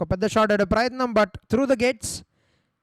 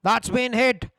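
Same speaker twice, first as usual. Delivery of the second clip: ordinary delivery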